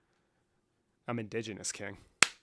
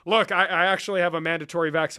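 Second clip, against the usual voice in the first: usual speaking voice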